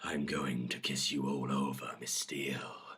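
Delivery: low, whispered promise; hushed seductive murmur; said softly. A narrator's reading. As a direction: whisper it low, slow and intimate. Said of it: "he says softly".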